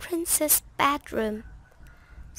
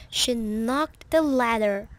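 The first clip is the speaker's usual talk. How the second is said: usual speaking voice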